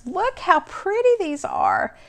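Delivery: high, slow, delighted; gushing delight. Real, not acted. Crafter showing viewers her birthday cards, delighted high coo.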